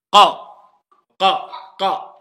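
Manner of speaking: loud separate "qa" from tongue root on back palate; emphatic qaf. Shown as a demonstration. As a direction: punch each qa far back in the mouth. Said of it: "Le fond du palais".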